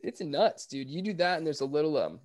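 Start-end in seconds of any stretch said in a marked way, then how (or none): 0.9-2.0 talking between sips of water; words around a mouthful of water; while sipping a drink. Real, not acted. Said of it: "excuse me, I'm drinking all this water".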